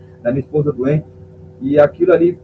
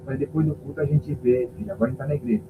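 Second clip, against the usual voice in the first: hushed reply; 2 semitones lower, 7 dB quieter than usual. Retold or imitated. Hushing his daughter in church.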